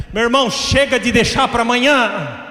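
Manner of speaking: exhortation rising to a high shout; urgent preaching. Real, not acted. Preacher urging a brother not to wait, building exhortation. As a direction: press them not to delay.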